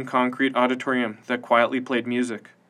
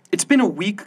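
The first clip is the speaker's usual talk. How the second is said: high, louder snap; snapped; 8 semitones higher, 5 dB louder than usual. A narrator's reading. Matilda cooped up in the safe house, snapping at Kyle. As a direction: bite the words off in frustration. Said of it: "Matilda snapped".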